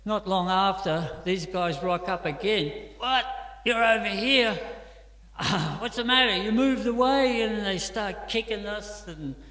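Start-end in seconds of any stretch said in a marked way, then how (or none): 3.0-7.4 loud, high jeering taunt; mocking thug taunt. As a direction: jeer at them like a bully, loud and high. Thug taunting them on return.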